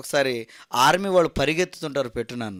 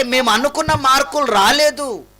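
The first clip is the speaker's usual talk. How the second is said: higher, louder cry of despair; anguished lament; 7 semitones higher, 5 dB louder than usual. Acted. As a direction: wail over the failed marks. Students voiced before their suicide. (బాధతో గట్టిగా వాపోవడం)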